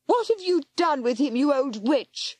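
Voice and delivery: high, slowing angry scream. A narrator's reading. Girl screaming at the old woman. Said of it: "she screamed".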